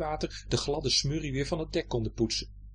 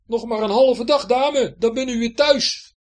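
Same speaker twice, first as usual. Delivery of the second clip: high, loud call, slowing; 10 semitones higher, 10 dB louder than usual. Acted. Hendrik calling down to Betje, loud cheer. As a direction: call the good news down. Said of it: "hij riep erachteraan".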